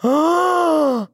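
high gasped oh on intake. Retold or imitated. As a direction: gasp the oh in shock. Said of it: "it was like this intake of air".

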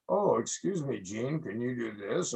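mock timid photographer asking Gene politely; mock polite request. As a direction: tiptoe around the star, timid. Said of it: "It's not".

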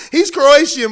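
loud, high, through laughter; real laughter spilling into the words; laughing. Real, not acted. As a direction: talk while still cracking up. Still laughing after his impression.

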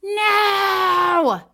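long, high, loud shout; yelled no. Retold or imitated. Showing cohost what she yelled at the screen, angry yell. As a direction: holler a drawn-out angry NO. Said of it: "What did you yell exactly?".

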